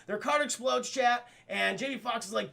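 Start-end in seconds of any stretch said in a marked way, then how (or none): none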